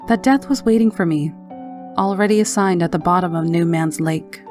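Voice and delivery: ordinary delivery, nothing marked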